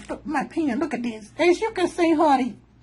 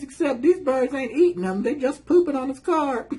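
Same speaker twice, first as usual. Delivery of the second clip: ordinary delivery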